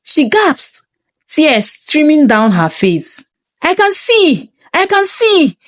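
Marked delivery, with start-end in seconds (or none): 3.6-5.6 high, ecstatic cry of joy; joyful cry. A narrator's reading. Healed woman crying out to the crowd in joy. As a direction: cry it out as sight returns. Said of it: "she cried".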